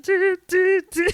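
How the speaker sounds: high, loud "doo doo doo"; mock clarinet honks, high and loud; imitated clarinet tooting. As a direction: toot out clarinet notes by voice. Imitating her clarinet playing while distracted.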